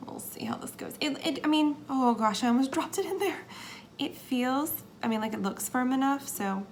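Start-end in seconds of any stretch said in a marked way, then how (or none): 0.1-0.9 low tense whisper; nervous whisper. Real, not acted. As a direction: hush it while concentrating. Nervous placing embeds.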